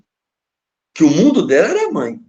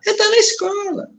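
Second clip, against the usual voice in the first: much higher, humble mock mother. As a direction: answer meekly to the police chief. Mãe responde ao delegado. (mãe humilde respondendo ao delegado, voz aguda)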